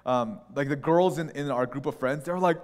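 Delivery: usual speaking voice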